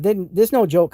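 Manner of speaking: ordinary delivery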